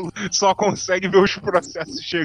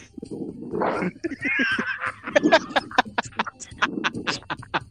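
breathy silent laugh; 8 semitones higher, 4 dB quieter than usual. Real, not acted. Question catches him off guard.

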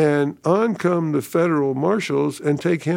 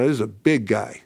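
normal talking voice

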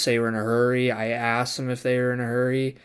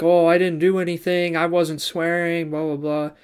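higher-pitched imitation of a client playing innocent; put-on "who, me?" denial, pitched up; mock innocent protest; 5 semitones higher, 4 dB louder than usual. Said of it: "starts trying to play it off".

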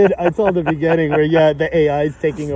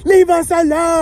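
high-pitched parody holler; mock yelling; 11 semitones higher than usual. South Park royals' big sign, mock yelling. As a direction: holler it like outraged cartoon royals. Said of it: "they're, like, yelling everywhere".

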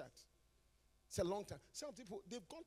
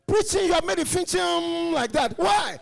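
higher, louder joyful shouts; exuberant exhortation; 8 semitones higher, 7 dB louder than usual. Real, not acted. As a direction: urge them to be happy. Exhorting joy.

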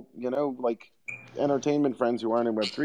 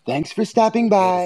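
put-on voice; 8 semitones higher, slower than usual. Put-on slow goodbye.

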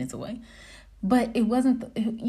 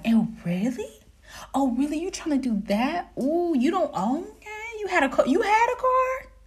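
put-on impressed questions climbing higher; feigned belief; 7 semitones higher than usual. Woman humoring a date's lies, feigned amazement. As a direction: play along, rising into exaggerated high-pitched wonder. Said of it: "let them cap and think that I believe it".

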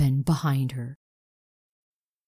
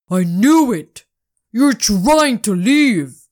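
loud, slow accusing yell; angry shout; 4 semitones higher, 6 dB louder than usual. Acted. Owner catching Spirit escaping, shouting angrily. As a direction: catch her red-handed, furious. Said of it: "said the owner".

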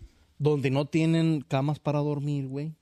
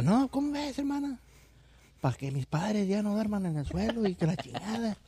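put-on high, girlish voice of the sister; high-pitched little sister urging her sibling; 6 semitones higher than usual. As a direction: squeak it out like a young village girl.

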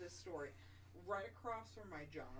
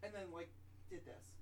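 ordinary talk, nothing marked

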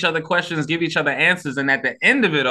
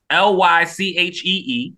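ordinary talk

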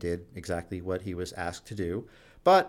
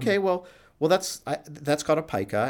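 higher-pitched, halting reassurance; feigned casual response, pitched up and hesitant; pretending it's normal; 7 semitones higher than usual. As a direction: imitate a doctor trying to normalize it. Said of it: "I have to sort of pretend, like, you know, normalize it".

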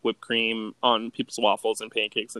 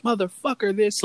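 retold angry outburst, much higher; mock screaming; 10 semitones higher, 4 dB louder than usual. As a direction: imitate an ex screaming abuse, only somewhat louder. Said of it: "screaming at him".

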